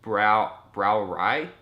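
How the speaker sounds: halting, puzzled echo; mock confusion, groping at the words; baffled shop worker. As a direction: imitate someone failing to understand.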